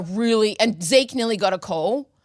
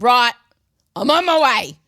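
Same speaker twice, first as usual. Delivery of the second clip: urgent; 3 semitones higher, 8 dB louder than usual. Retold or imitated. Retold urgent reply to friend.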